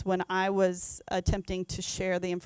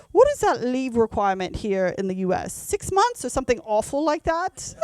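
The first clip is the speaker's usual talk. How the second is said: a hammed-up British accent that goes much higher by the end; horrible British accent; 4 semitones higher, 4 dB louder than usual. British CEO asking about US leave, in a bad British accent. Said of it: "I'll use my horrible British accent here".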